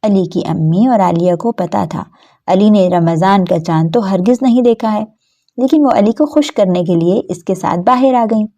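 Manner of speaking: ordinary delivery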